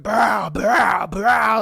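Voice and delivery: loud pow pow gunshot noises; shouted gunshots. Retold or imitated. Shooter opening fire on his friend, shouted gunshot noises. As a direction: shout the shots out like a gun firing. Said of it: "whips out a gun and he's just like".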